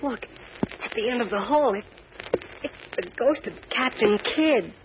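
frightened. Acted. Boy sees a ghost.

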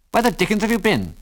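sharp, high-pitched snap; terse snap. A narrator's reading. Pilot to friend who was gone too long, terse snap. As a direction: snap it with irritation. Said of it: "snapped Biggles tersely".

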